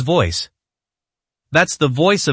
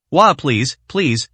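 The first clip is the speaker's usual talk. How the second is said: usual speaking voice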